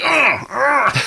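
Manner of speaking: high-pitched mock grunts, imitating someone; imitated grunts. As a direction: grunt twice in a put-on voice, pitched well up. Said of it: "He's doing the Jay from last week".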